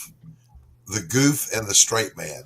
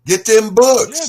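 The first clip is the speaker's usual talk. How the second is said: high loud drawn-out shout; hyped shout; 10 semitones higher, 8 dB louder, much slower than usual. Acted. Echoing slogan, loud and high.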